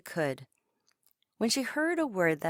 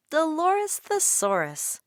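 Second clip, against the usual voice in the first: a teacher calling her pupil by name, slowly and higher; Miss Myers; 9 semitones higher, 5 dB louder than usual. A narrator's reading. Teacher Miss Myers greeting Dolores, higher and slow. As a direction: greet the girl fondly, drawn out. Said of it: "Miss Myers would say".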